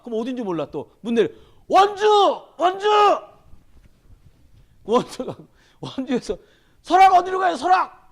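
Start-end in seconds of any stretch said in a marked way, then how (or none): 1.7-2.9 high, loud calling of the town name; shouting. Retold or imitated. Lost driver shouting the town name to passersby, loud call. 6.8-8.0 high, loud shouted question; shouting. Retold or imitated. Lost driver yelling to ask the way, loud shout.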